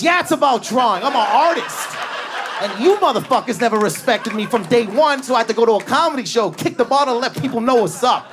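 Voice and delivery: high, loud proud declaration; boastful artist. Retold or imitated. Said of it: "He goes".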